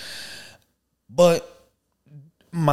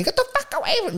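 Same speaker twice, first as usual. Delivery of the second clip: very high mock shriek; squealed mock yell, not truly loud; really loud cussing; 4 dB quieter, much faster than usual. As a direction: imitate a screeching woman cussing. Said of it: "like, really loud".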